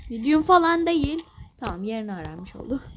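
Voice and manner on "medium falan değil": loud, higher protest; indignant outburst. Real, not acted. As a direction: push back loudly at the game. Angry at difficulty label.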